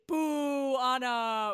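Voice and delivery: low falling jeer; long mocking heckle; drawn-out boo. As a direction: boo the rival like a crowd. Jeering the rival candidate.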